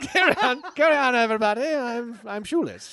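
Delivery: high and loud, laughing through a posh British line; laughing posh accent. Pretend. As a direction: laugh out the bit as an English toff.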